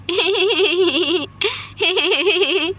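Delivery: put-on long bleating giggle in a child's voice; childish giggle. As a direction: giggle on and on like a silly kid. Childlike Leela giggles at auntie. (बच्चों जैसी खिलखिलाहट)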